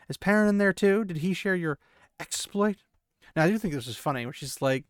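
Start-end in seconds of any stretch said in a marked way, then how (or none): 0.1-2.8 higher, insistent questioning; vexed questioning. A narrator's reading. Moraine demanding to know if Perrin went too, vexed. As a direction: press them with raised pitch. Moraine interrogating the boys in story.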